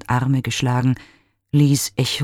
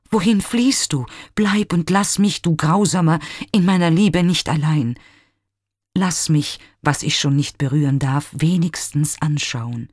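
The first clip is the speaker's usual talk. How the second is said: higher, louder pleading outcry; desperate cry; 3 semitones higher, 3 dB louder than usual. Acted. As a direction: cry out after the fading image. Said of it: "schrie er". (verzweifelter, lauter Aufschrei)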